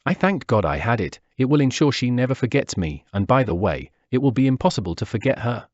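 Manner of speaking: ordinary delivery, nothing marked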